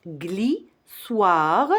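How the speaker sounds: GLISSOIR split into gli... soir; rising "gli", pause, then "soir" in glissoir; syllable by syllable. Shown as a demonstration. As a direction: sound out glissoir in two separate beats. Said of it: "il y a seulement deux syllabes".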